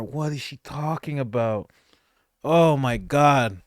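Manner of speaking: ordinary talk, nothing marked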